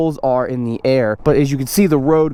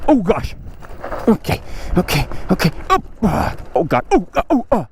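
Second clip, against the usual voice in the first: normal talking voice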